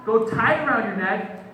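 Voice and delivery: loud, high-pitched order; mafia-style threat. Acted. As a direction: throw it out as a forceful threat. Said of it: "he goes a little mafia".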